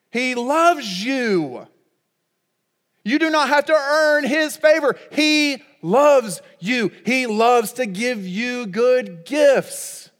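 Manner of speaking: preacher crying out God's love to the congregation; preaching at full force. Real, not acted. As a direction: lift it up over the room, slow.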